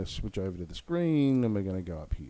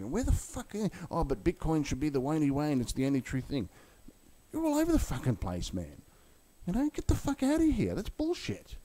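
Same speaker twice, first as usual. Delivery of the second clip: pitched way up, ranting in disbelief; exasperated rant; 5 semitones higher than usual. Real, not acted. Vlogger yelling at Bitcoin maximalists, high exasperated rant. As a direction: let the outrage spill over at them.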